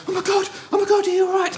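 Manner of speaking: very high, frantic, tearful; tearful Lucy. Retold or imitated. Lucy in tears running to him after the fall, very high and frantic. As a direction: shriek it out in high panicked concern.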